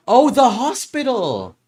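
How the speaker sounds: slow, loud burst of realization; excited realization. Acted. Detective realizing the clue, loud and drawn out. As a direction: cry out as it clicks.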